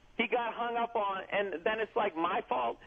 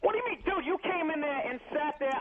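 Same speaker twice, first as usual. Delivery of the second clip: exasperated; 6 semitones higher than usual. Real, not acted. Heated radio argument.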